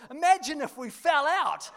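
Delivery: put-on high, loud boyish tease; son's cheeky quip. Son Jack teasing his dad upside down, high and loud. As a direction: toss it off like a grinning kid. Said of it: "He goes".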